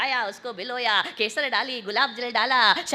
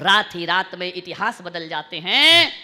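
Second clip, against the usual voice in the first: louder line swelling at the end; emphatic recitation; 5 semitones lower, 5 dB louder than usual. Real, not acted. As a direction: start measured, then project the ending. Second line repeated louder.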